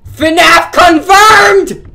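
loud, high, drawn-out proclamation; mock-triumphant, slow high shout. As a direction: bellow it slowly like a big reveal. Mock triumphant announcement of a find.

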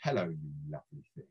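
low, soft, quick hello; polite greeting. Acted. Narrator politely greeting the Bacon painting, low and soft. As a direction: greet softly, pitched well down. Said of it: "be polite".

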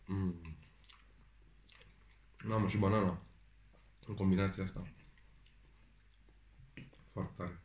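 talking with food in mouth; eating between words; chewing food. Real, not acted. Seen 0: Just ate a forkful; jaw working between phrases while cutting food.

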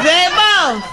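high, loud call through a laugh; laughing high shout. Acted. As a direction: call over to the boss. Man calls out to posse.

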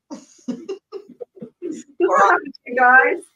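drawn-out, loud laughing ask; laughing question. Real, not acted. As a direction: laugh it out to the audience.

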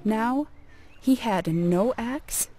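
plain reading voice

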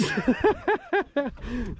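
high burst of laughter at a mate's bad luck. Real, not acted.

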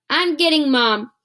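loud, high threatening shout; angry yell. Acted. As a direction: storm off, loud. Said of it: "Nancy yelled".

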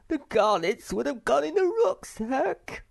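miserable high-pitched wail; Sniff. A narrator's reading. Sniff laments the garnets to Snufkin, wailing miserably. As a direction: whine it loudly in a tiny, tearful voice. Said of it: "said Sniff miserably".

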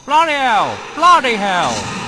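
loud, high startled yell; repeated cry of alarm; shouted bloody hell. Real, not acted. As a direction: blurt it out in shock.

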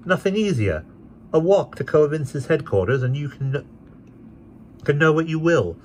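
high, light carefree voice; airy gentleman. A narrator's reading. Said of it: "Mister Skimpole".

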